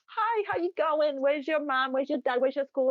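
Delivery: high, bright, eager questions; cheerful greeting to kids. Retold or imitated. Asking local kids about their parents, high and eager. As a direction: chat up the children with delight. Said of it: "normally when I see kids".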